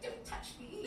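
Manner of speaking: hammed-up offended whisper; mock whispered prissy. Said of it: "doing the thing".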